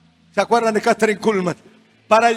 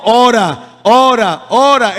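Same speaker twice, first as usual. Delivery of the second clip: loud, insistent repeated order; acted command; 7 dB louder, much slower than usual. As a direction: imitate an urgent divine command. Acts God's repeated command.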